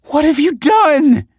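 high, loud, slow cry of horror; wife crying out at the sight; anguished cry. A narrator's reading. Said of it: "she cried".

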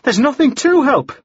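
high-pitched, heated protest; crying out. A narrator's reading. Woman rejecting the man's offer of help, crying out. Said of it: "Anna cried".

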